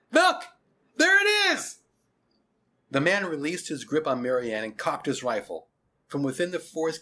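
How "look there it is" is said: high, full shout of alarm. A narrator's reading. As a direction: shout out a sighting to other men. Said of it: "Some men started to shout".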